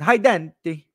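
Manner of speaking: put-on foreign accent; Alessandro's foreign accent. Watch seller messaging a customer on WhatsApp, mock foreign accent. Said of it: "I'll do it in the accent".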